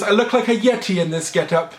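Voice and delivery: a put-on Mrs. Doubtfire complaint; Mrs. Doubtfire impression. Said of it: "and she goes".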